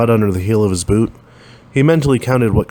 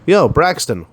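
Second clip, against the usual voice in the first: called over; 7 semitones higher, slower than usual. A narrator's reading. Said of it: "one called over".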